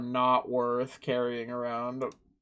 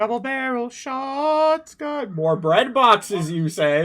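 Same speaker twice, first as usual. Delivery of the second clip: hammy character voice; 9 semitones higher, 5 dB louder, slower than usual. Pretend.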